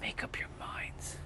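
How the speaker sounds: breathy whisper under the breath, no voice; whispered aside. Real, not acted. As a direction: mutter it again to yourself, barely audible. Repeats the complaint under breath.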